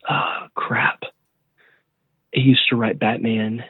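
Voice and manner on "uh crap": whispered to himself; under the breath whisper. Real, not acted. Muttering while searching for name.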